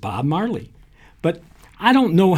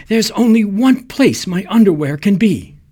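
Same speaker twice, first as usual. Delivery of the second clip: higher, sharp and knowing; pointed woman's voice; 7 semitones higher, 4 dB louder than usual. A narrator's reading. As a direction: accuse with a raised voice. Woman hints at affair.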